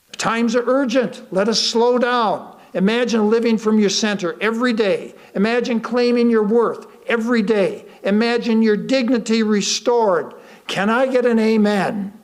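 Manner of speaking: rally them toward an amen; preaching build. Acted. Preacher rallying the audience to answer amen, fervent.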